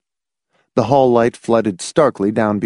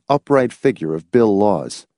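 ordinary delivery

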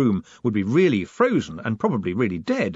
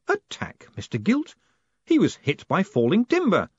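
sharp, high indignant reply; indignant surprise; 9 semitones higher than usual. Acted. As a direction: bristle at the word attack. Moist retorts sharply.

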